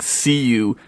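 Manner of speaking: normal talking voice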